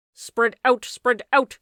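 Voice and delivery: loud urgent call; shouting. A narrator's reading. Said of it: "cried someone".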